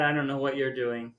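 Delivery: ordinary delivery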